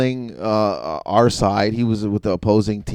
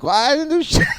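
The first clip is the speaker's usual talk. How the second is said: very high, incredulous squeak; exasperated protest. Retold or imitated. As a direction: whine it in disbelief. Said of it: "I'm like".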